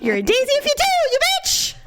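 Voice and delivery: high, hammed-up taunt; mock gunslinger fury. Shopper playing gunslinger at the rude woman, mock fury. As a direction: hurl it as a playful high-pitched challenge. Film quote turned into insult.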